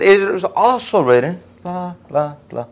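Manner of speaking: high, slow mock comeback; Jesus. Jesus answering the devil, high deliberate retort. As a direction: counter high and deliberately, then blah it away. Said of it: "Jesus keeps on responding with".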